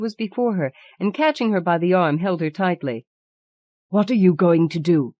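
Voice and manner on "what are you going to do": ordinary delivery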